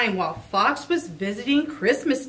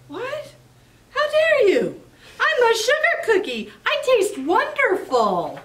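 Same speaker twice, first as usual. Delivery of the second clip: very high indignant squeal; be deeply offended at the insult; outraged cookie; 11 semitones higher, 4 dB louder than usual. Acted. Cookie insulted by Fox, very high and indignant.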